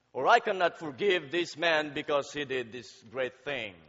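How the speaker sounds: ordinary delivery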